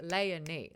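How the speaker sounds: "Leonie" as LAY-uh-nee, spelled out in sound; narrator's pronunciation. Retold or imitated. Host shows what bothers her, "Leonie" as LAY-uh-nee. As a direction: stress LAY in "Leonie", short middle. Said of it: "She says Leonie in it, but it's like".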